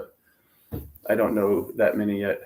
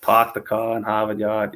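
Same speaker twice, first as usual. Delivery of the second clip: R's dropped on "car", "Harvard" and "Yard"; mock Boston accent. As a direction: say it like a Bostonian in The Office. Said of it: "The Office".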